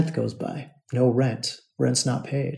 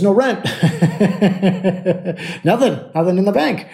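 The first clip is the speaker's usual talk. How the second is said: higher-pitched, breaking into a laugh; laughing in disbelief; 7 semitones higher than usual. Real, not acted. Landlord telling of the missing rent, laughing. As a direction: laugh through the words.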